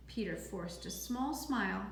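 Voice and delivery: ordinary delivery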